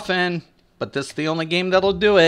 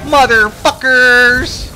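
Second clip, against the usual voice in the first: drawn-out furious yell, pitched way up; angry yell; 10 semitones higher, 7 dB louder, much slower than usual. Retold or imitated. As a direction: yell it slowly in a rage. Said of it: "I was like".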